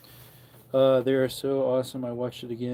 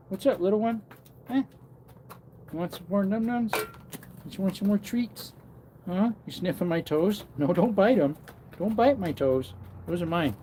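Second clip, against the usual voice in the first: pet talk; 7 semitones higher than usual. Real, not acted. Greeting donkeys sniffing his toes, higher baby talk. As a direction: coo at the donkeys like babies.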